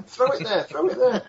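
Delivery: very high, comic frantic directing; urgent instructions. Acted. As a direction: squeal orders at the bucket carriers. Character directs firefighters from afar.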